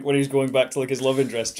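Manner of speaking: normal talking voice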